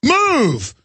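high mocking brush-off; mock cop telling him to move; imitating a rude cop. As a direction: sneer it out as the dismissive cop. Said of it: "He goes".

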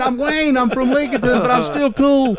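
high-pitched, mild mock yell; retold screaming. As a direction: call out your pitch to her, half-yelling. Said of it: "I'm still screaming through the glass".

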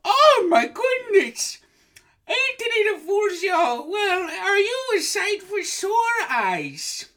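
very high, loud, gushing granny voice; a shrieking delighted old woman; high old lady voice. Acted. Host in falsetto as psychic, gushing shrill greeting. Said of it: "doing the voice".

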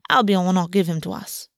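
usual speaking voice

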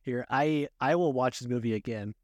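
ordinary delivery

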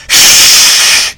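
a burst of laughter; loud laugh. Real, not acted. Laughs at Piper scaring Okerlund.

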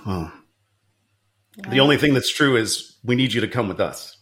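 lightly voiced serious agent; low, grave secret agent; stern agent voice. Agent recruiting in a sketch.

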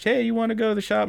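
high, fast chipper voice; cheery invite. Retold or imitated. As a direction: squeak the offer brightly. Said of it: "She's like".

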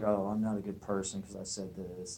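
low, soft, guilty muttering to oneself; lightly acted self-reproach, hushed and low; dejected self-talk. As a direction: quietly imitate someone feeling bad afterwards. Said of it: "you feel horrible ... you're like".

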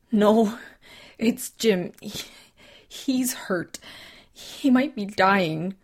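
distraught. Acted. Telling rescuers Jim dying.

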